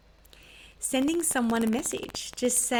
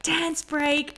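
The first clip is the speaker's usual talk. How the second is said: raised, higher-pitched mock shout; a retold classroom yell, pitched up; imitating kids' scream; 6 semitones higher, 3 dB louder than usual. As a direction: call it out like excited schoolkids, not full volume. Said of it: "They would scream out".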